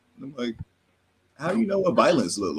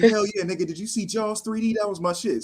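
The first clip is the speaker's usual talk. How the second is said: eager mock Stevie; 9 semitones higher than usual. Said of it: "It's like".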